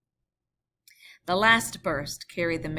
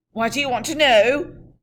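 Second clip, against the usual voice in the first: loud, higher demand; guard demanding; 7 semitones higher, 12 dB louder than usual. Acted. Guard challenging the rider, loud. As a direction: challenge him.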